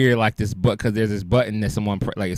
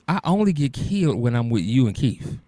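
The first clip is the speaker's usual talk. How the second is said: usual speaking voice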